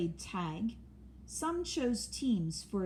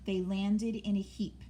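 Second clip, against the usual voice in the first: ordinary delivery, nothing marked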